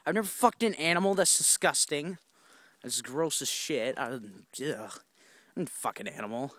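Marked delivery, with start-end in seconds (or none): none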